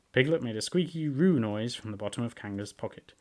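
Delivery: ordinary delivery, nothing marked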